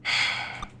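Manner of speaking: long, soft exhale; breathy sigh of exasperation; weary sigh. Real, not acted. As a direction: let out a resigned sigh. Exasperated by the kobold fight.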